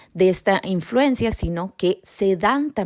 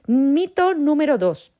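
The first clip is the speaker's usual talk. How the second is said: announcing headline; 8 semitones higher, 4 dB louder than usual. Acted. Announcing next myth.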